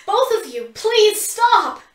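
high, loud desperate shout; frantic shout; pleading shout. A narrator's reading. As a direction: scream at them to stop.

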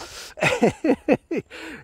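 high real laugh; helpless amused laughter; burst of laughter. Real, not acted. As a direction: laugh hard at the memory. Laughing at the sergeant memory.

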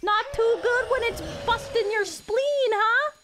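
high, loud gloating taunt. Pretend. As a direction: jeer it while swinging. Taunting a boss in combat.

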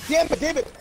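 high-pitched frantic outburst; urgent, high frustrated yelps; frantic cursing. Real, not acted. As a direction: curse rapidly in a high-pitched panic. Frantic in the fight.

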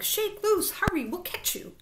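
high, hurried encouragement; urgent shout. A narrator's reading. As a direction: urge a dangling friend on. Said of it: "shouted the prairie dog".

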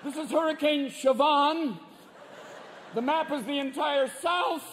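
high, strained TV presenter; freaked-out weatherman. Acted. Weatherman reporting a monster hurricane to viewers, freaked out. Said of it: "the guy in the Weather Channel is freaked out".

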